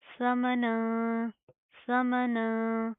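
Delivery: समना drawn out slowly with a humming nasal ending; समना chanted long, sound held in the nose; nasalised recitation. Shown as a demonstration. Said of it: "ना के अंदर sound पैदा करेंगे".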